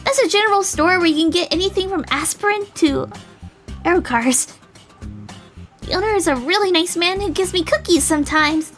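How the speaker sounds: put-on high, eager girl voice; bubbly, high-pitched chatter; excited dandelion girl. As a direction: gush as if pointing out favourite shops.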